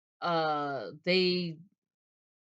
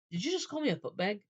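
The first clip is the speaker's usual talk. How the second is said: quick, higher-pitched puzzled question; incredulous; 5 semitones higher, faster than usual. Acted. Man double-checking what she called him, incredulous. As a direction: break off the apology in disbelief. Acted reaction to odd insult.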